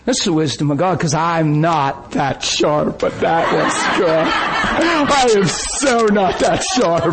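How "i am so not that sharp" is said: slow, laughing self-mockery; real laughter at himself. Real, not acted. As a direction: laugh it out, slowly. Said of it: "laughing at self".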